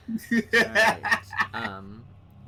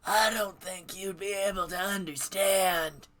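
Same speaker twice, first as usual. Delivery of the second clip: put-on cranky old hermit calling out; old man voice; 7 semitones higher, 6 dB louder than usual. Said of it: "how to do old man voice".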